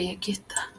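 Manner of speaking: breathy whisper to herself. Real, not acted. Teacher whispering to herself while finding the verse on the page. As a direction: drop to a private whisper while finding the line.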